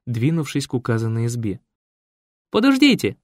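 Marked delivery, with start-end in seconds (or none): 2.5-3.2 high, loud call after him; shout. Acted. Raisa shouts after the leaving journalist, loud and high. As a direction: call him back sharply. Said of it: "крикнула Раиса".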